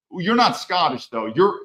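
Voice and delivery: ordinary delivery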